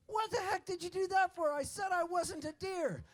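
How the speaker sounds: high, indignant squeal of complaint; wounded man complaining up high; hurt shot man. Acted. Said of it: "And he goes".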